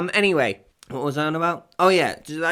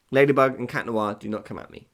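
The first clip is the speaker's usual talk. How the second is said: usual speaking voice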